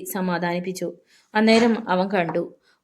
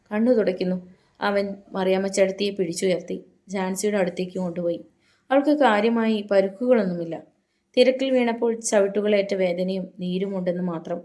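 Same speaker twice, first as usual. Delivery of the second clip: ordinary delivery, nothing marked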